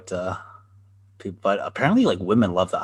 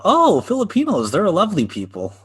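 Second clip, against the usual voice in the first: high, louder, over-delighted stranger; mock gushing; 11 semitones higher, 6 dB louder than usual. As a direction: gush it in mock delight, pitch leaping up. Retold stranger gushing over Filipinos.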